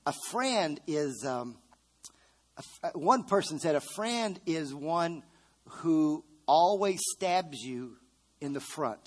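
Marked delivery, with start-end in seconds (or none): 3.8-9.0 slow punchline. Acted. Punchline delivered slowly.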